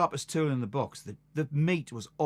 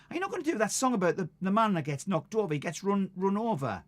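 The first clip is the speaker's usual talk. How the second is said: put-on quoting the girl; 7 semitones higher, faster than usual. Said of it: "she went".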